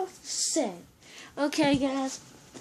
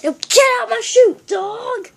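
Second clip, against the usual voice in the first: high, loud mock-angry shout; acted outburst; 9 semitones higher, 11 dB louder than usual. Presenter ordering dog out, shouted. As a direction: yell it at the dog. Skit outburst, then apologizes.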